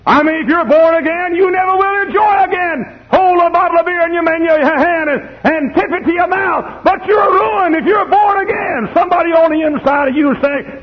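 very high shouted sermon; impassioned preacher's holler; full-force preaching. Real, not acted. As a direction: shout it from the pulpit, high and sustained.

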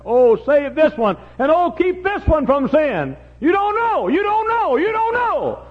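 high, loud and slow impassioned preacher's cry; full-force preaching. Acted. Preacher crying out prayers for the young, impassioned. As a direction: pour it out like a desperate prayer.

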